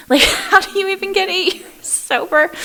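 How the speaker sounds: high, laughing amazement; laughing disbelief. Retold or imitated. To the owner, laughing in amazement, high-pitched.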